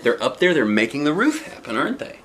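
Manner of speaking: usual speaking voice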